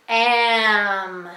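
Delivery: "Am" drawn out as one continuous sound; slowly, without stopping. Shown as a demonstration. Teacher saying words along with the child, "Am" held slowly. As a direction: stretch both sounds of "Am". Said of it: "say the words slowly with me".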